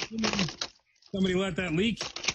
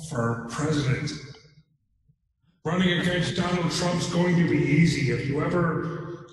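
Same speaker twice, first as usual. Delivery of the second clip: Jesse Ventura; 3 dB louder than usual. Acted. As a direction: cut a campaign promo, losing the thread. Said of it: "cutting a promo on Biden and Trump".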